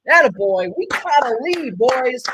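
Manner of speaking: loud, high cheer for the lead. Real, not acted. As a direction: celebrate it to the whole room. Cheering the go-ahead run.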